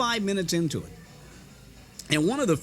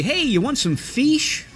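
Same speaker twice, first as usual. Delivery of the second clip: Indiana hillbilly mom; 8 semitones higher, 5 dB louder, slower than usual. Retold or imitated. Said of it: "terrible hillbilly accent".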